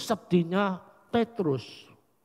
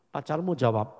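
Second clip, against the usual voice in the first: usual speaking voice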